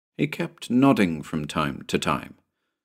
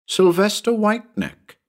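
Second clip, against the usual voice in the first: put-on a much higher young boy's voice; child's answer; 12 semitones higher than usual. A narrator's reading. Tommy answering Mr Cabot, innocent high boy's voice. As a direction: say it brightly, without hesitation.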